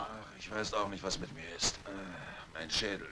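coming round from being drugged; dazed and groggy. Acted. Just woke up, drugged.

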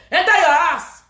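high, loud command; shouted order. Real, not acted. Ranter ordering someone home, high shouted command. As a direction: send them off with a shout.

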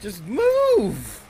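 high, loud desperate yell; scared horror game streamer. Real, not acted. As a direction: shout it at the screen. Yelling at the game.

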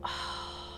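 soft, voiceless sigh; quiet sigh of dismay; heavy sigh. Real, not acted. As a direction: breathe out in regret. Regretting the mistake.

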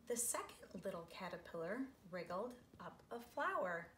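gentle storybook read-aloud; lilting picture book voice; reading to children. A narrator's reading. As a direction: read it like story time for little kids.

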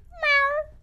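high squeaky playful meow; sudden cutesy kitten meow; cat meow. Pretend. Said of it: "Ain't no way she just meowed?".

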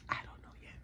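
a soft unsure whisper. Real, not acted. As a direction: whisper it to yourself. Unsure about camera setup.

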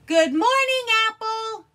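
very high, loud scream. Acted. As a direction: scream it to wake him. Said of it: "he screamed".

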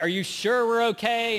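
mock worried friend asking again; anxious needy friend. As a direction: ask again like you fear the answer. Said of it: "needy, insecure friend".

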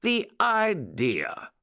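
high, drawn-out mock protest; protesting exclamation. A narrator's reading. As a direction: exclaim it slowly, as if affronted. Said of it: "exclaimed the bunny".

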